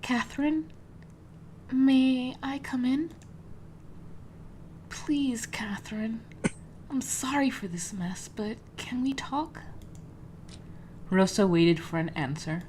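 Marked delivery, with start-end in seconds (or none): 0.0-9.6 whispering. Acted. Rosa whispers at Catherine's door.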